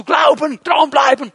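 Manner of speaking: God teasingly echoing Moses' loud encouragement; mock rallying shout. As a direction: shout the slogans like a pep rally. God mimics Moses' rallying cries.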